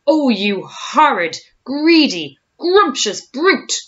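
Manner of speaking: loud, slow, rising outrage; indignant cry. A narrator's reading. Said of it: "cried Humpy Rumpy".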